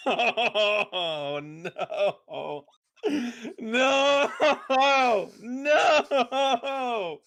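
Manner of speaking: loud, high wail of "no" through laughter; laughing dismay. Real, not acted. Reacting to a joke not expected, wailing with laughter.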